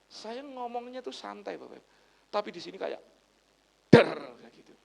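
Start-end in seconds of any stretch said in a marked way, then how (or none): none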